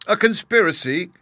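high, loud, thrilled exclamation; gleeful and loud, rising into a question; delighted cry. A narrator's reading. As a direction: cry out in delight, pitch leaping up. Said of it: "cried the delighted lady".

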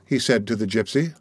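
ordinary delivery

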